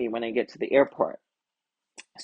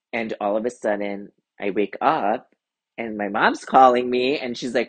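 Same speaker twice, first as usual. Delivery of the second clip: usual speaking voice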